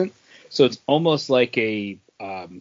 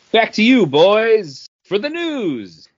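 high, loud announcer toss to the news; mock anchor handoff; 10 semitones higher, 7 dB louder, slower than usual. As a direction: hand it off like a TV anchor. Podcast host handing off segment.

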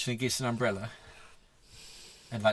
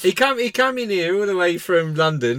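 higher, louder mock northern grumble; mock Mancunian rant; 5 semitones higher, 6 dB louder than usual. Co-host mocks a pompous Londoner in a northern accent. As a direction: send up a Londoner who thinks he's royalty. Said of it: "Mike Baldwin's coming to Coronation".

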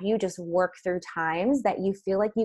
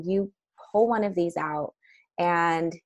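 normal talking voice

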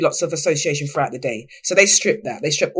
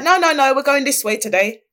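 sharp, raised no no no, then settling; officers cutting her off; 8 semitones higher, 3 dB louder than usual. Retold or imitated. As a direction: firmly stop someone heading the wrong way. Officers redirect her, retold.

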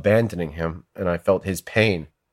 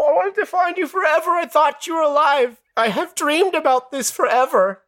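high, tearful, desperate protest; tearful pleading. Retold or imitated. Pleading with the dead friend in the dream, tearful. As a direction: beg him to believe you, near tears. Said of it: "I was pleading with him".

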